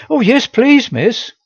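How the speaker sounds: eager, high and bright; enthusiastic. Acted. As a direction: jump at the chance eagerly. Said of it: "with an enthusiasm".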